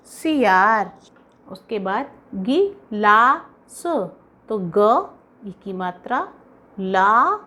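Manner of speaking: drawn-out slow dictation voice. Shown as a demonstration. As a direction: read it slowly for children to write. (बच्चों को लिखवाते हुए धीमी आवाज़)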